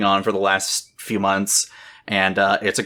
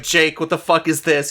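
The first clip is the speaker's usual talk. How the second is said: mock livid; 6 semitones higher than usual. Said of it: "I was livid".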